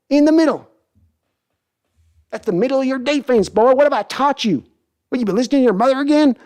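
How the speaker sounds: very high mock cranky old owner; Jerry Jones impression. Jerry lectures his son about defense, very high mock scolding. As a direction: scold the boy like a stubborn patriarch. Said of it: "Jerry and Sons impression".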